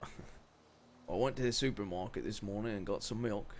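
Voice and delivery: slow British accent slipping into Cockney. Shown as a demonstration. Repeating a given line on request, British accent. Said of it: "a British accent".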